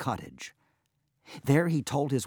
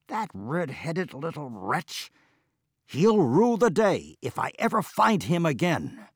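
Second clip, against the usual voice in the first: vengeful threat; 7 semitones higher, 5 dB louder than usual. Acted. Threatens the dwarf.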